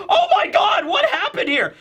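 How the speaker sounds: shrill high panic, laughing through; hammed-up horror at the body; panicked laughing shout. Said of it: "It's like".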